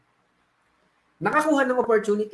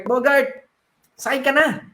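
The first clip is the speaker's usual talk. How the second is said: calling out; 4 semitones higher than usual. Acted. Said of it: "sinisigawan si Bogart".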